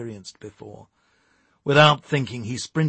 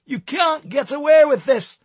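loud, high-pitched protest; hollering; 9 dB louder than usual. A narrator's reading. Stuart protests while being hauled out, hollering. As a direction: holler it in helpless outrage. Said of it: "he hollered".

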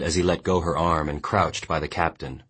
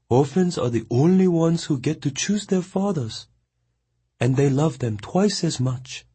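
higher, tender woman's voice; tender cooing; 10 semitones higher than usual. A narrator's reading. Mongnan tenderly rocking the boy like a child. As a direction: coo to him like a child.

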